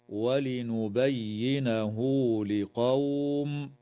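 ولنبينه said as "ولنبيّنهو" with a two-beat U; ha joined with a long U. Shown as a demonstration. Teacher showing the small connecting madd, slow recitation. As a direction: stretch the -hu for two beats before لقوم. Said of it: "وتوصل هاء الضمير بواو لفظية".

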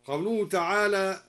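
ordinary talk

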